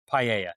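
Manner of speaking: "paella" said pie-AY-uh, with a New Jersey ring; New Jersey pronunciation. Real, not acted. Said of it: "That's the New Jersey pronunciation of it".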